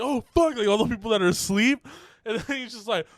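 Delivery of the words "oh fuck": caught out. Retold or imitated. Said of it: "everyone's just like".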